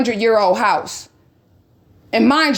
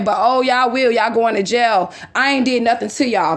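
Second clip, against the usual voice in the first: ordinary delivery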